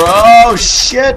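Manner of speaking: sudden loud high yelp; startled curse. Real, not acted. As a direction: blurt it out in shock. Sudden death in game.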